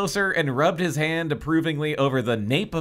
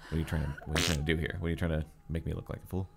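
fast quiet mutter; 9 semitones lower, 10 dB quieter, much faster than usual. Acted. Jessica voiced, fast and quiet.

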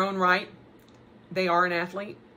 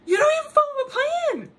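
incredulous; 5 dB louder, much faster than usual. Retold or imitated. Incredulous self-quote.

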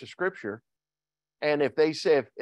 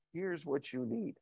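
gentle divine voice; 3 semitones higher, 13 dB quieter than usual. Acted. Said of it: "the Lord said".

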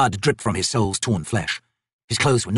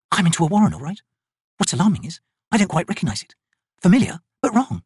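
lightly voiced wizard; 7 semitones higher than usual. Quick Ben speaks, worried.

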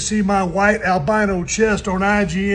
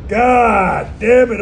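drawn out curse; 3 semitones higher, 4 dB louder, much slower than usual. Real, not acted. Numbing in the cold plunge.

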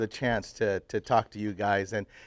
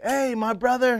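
friendly call; 11 semitones higher than usual. Retold or imitated. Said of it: "I roll down the window and said".

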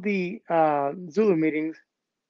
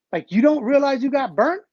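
taunting; 7 semitones higher than usual. Retold or imitated. Fable taunting the loser.

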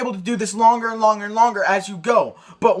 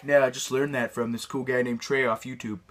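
quiet; 8 semitones lower, 8 dB quieter than usual. Retold or imitated. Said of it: "you're gonna be like".

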